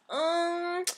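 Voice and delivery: high, whined filler sound; whiny voice. Acted. Comedian imitating whiny people, mock whining. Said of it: "people who have that whiny voice".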